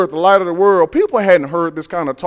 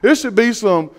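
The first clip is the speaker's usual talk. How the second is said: ordinary talk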